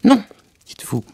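a sharp burst, then retort; indignant cry. A narrator's reading. The mouse trembles at Alice's words, indignantly crying out. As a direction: exclaim in mild outrage. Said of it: "s'écria la souris en tremblant".

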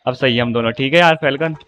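ordinary talk

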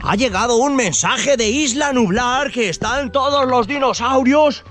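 put-on rally the kids like a commander; rescue mission leader. Dad plays rescue mission leader.

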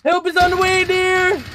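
high, loud playful call; sing-song shout. Pretend. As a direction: belt it out like a hero. Teammate down, jokey call.

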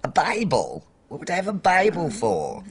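indignant. Acted. Indignant at the guess.

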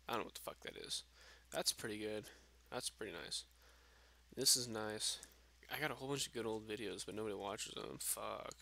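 usual speaking voice

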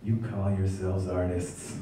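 the comeback he wished he'd said, quiet and snooty; mock haughty put-down. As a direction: deliver a quiet snooty burn. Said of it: "That's what I should have said".

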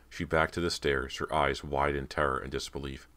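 usual speaking voice

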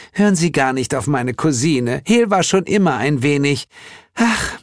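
high, airy apology for a relative; light, searching sigh; dismissive spring goddess. A narrator's reading. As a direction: wave it off in a sweet high voice.

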